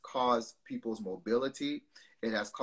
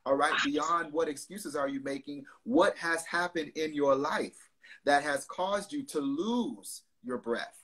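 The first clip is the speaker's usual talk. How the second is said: raised, pressing preacher questions; preaching exhortation; 5 semitones higher, 6 dB louder than usual. Acted. Pastor pressing his listeners with questions, preaching style. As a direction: preach it, pushing each question at them.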